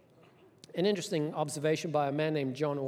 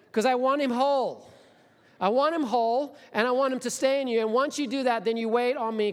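higher, louder and insistent; insistent command; 5 semitones higher, 5 dB louder than usual. Acted. God insists the fish keep Jonah whole, loud and high. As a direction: press every "want" home.

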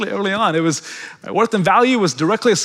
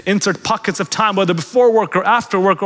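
normal talking voice

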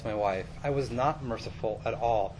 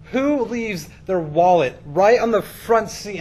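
exasperated scolding, higher, louder and drawn out; going off, scolding; 6 semitones higher, 7 dB louder, slower than usual. Retold or imitated. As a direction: reenact laying into someone with slow, loud disbelief. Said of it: "went off a little bit".

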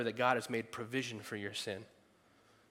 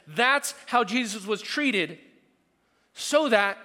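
loud, emphatic preaching; fervent preaching; 5 semitones higher, 6 dB louder than usual. Acted. Preaching at full force.

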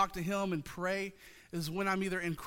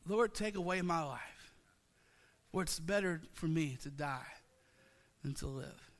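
despairing Jonah; 2 semitones lower than usual. Retold or imitated. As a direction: let the plea sink into hopelessness. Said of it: "feel the depth of Jonah's emotion".

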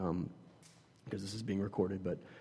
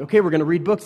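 upbeat announcement; 7 semitones higher, 12 dB louder, faster than usual. Retold or imitated. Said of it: "I'm like".

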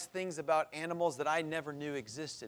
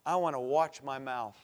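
ordinary delivery

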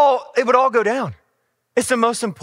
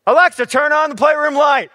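calling out; 8 semitones higher, 7 dB louder than usual. Retold or imitated. Daughter calls to Alexa.

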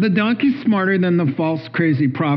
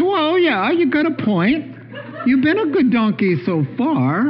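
much higher, laughing Balaam voice; Balaam answering; 10 semitones higher than usual. Acted. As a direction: let Balaam concede with a chuckle. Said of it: "He says".

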